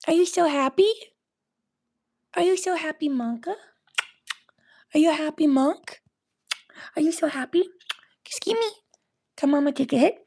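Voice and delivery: high, sing-song questions to a pet; excited pet talk. Real, not acted. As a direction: coo up high at your happy pet.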